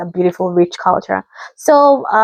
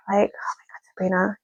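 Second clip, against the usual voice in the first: hushed, exasperated aside; soft, whispery disbelief; whispered dismay; 10 dB quieter than usual. Retold or imitated. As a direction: say it under your breath in dismay. Exasperated aside under the breath.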